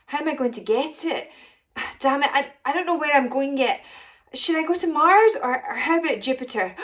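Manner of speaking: high, loud, rushed panic; panicking. Acted. Potion maker realising the potion is gone, loud panic. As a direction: babble it out in a flustered panic.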